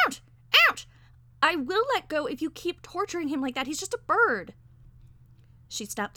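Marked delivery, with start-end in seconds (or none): 0.1-0.9 short, sharp little-bird cries; parakeet yelps. A narrator's reading. Parakeet being squeezed. 1.4-4.5 loud, raised-pitch scolding; heated, high protest; indignant threat. A narrator's reading. Quarrel over torturing the bird.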